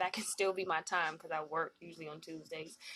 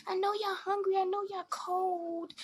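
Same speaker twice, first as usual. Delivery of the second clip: mock fake kindness; 11 semitones higher than usual. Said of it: "She's like".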